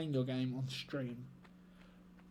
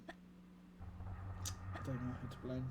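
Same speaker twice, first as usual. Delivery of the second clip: very slow, soft, breathy trailing off; defeated whisper; 10 dB quieter, much slower than usual. Real, not acted. Streamer after failing twice, slow soft whisper. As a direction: let it fade out in defeat.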